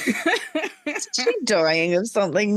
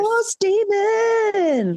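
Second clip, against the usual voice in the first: dramatic announcer; 13 semitones higher, much slower than usual. Pretend. Dramatic horse demon exclamation.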